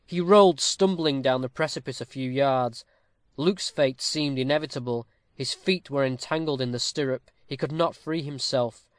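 ordinary delivery, nothing marked

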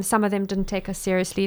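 usual speaking voice

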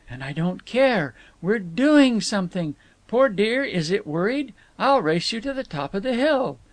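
high, excited, playful voice; gleeful. A narrator's reading. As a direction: let the glee lift the voice. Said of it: "she shouted gleefully".

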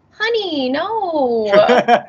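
mock-patronizing big sister; sassy dismissal. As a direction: shut her down with a knowing sigh. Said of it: "And I'm just like".

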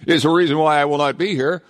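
laughing through the words; chuckling while explaining. Real, not acted. Laughing about missing Christmas show.

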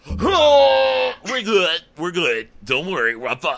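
high strained insistence, easing down; mock queasy reassurance. Co-host imitating a nauseous date, mock queasy reassurance. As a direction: act sick while claiming to be fine. Voicing sick man insisting he's fine.

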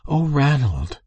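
high, exclaimed surprise, voice falling; crying out. A narrator's reading. Minister's wife hearing Ranald's news, crying out. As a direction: exclaim it high, then let it fall. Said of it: "she cried".

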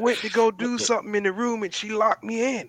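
high, lightly voiced scared friend; retold frightened coworker. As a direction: act out a spooked buddy. Coworker retelling being locked in.

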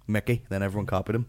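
ordinary talk, nothing marked

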